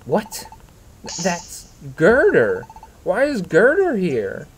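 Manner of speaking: slow, high, disbelieving stammer; stunned shock. Acted. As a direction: let the shock stretch every word. Shocked discovery of Girder.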